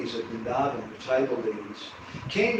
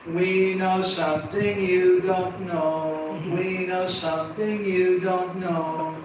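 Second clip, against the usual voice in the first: slow singsong taunt; teasing; 2 semitones higher, slower than usual. Retold or imitated. Boys teasing little Krishna with a secret, teasing singsong. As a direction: draw it out like boys chanting a secret. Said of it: "they began teasing Krishna".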